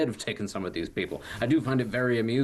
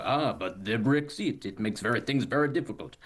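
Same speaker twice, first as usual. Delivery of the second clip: put-on French accent. Imitating the French shrugging off a plea for help, French accent. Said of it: "That was me doing a French accent".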